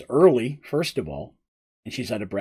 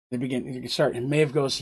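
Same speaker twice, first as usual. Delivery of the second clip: ordinary talk, nothing marked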